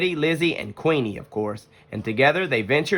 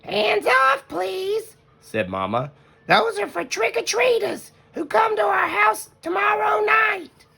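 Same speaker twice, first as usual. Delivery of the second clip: much higher, gentle mother bear voice; Mama Bear voice; slower than usual. Acted. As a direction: play the wise mother.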